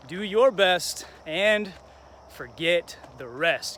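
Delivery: slow, emphatic quote delivery; deliberate quote. Acted. Host passing on a trainer's motto, slowly and deliberately. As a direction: spell out the motto slowly, word by word. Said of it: "He says".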